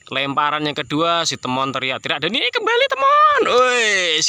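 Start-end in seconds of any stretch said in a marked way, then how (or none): 2.3-4.3 very high, very loud joyful cry; thrilled shout. Real, not acted. Owner thrilled his pigeon came back, high shriek. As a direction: shriek with delight as it lands.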